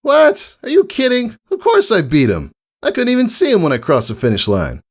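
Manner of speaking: very high, indignant, then fast bragging; mock-insulted champion; offended Rainbow Dash. A narrator's reading. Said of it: "Offense marked Rainbow Dash's face".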